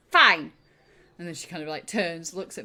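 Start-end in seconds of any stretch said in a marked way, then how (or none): 0.1-0.5 one loud, decisive word; loud, brusque sign-off; curt loud approval. Acted. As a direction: end the scolding at full volume. Shrilt closes the scolding.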